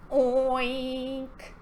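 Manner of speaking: long, drawn-out oink; pig oink. Pretend. Ending the pig verse for children, drawn-out oink. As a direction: stretch out one piggy oink.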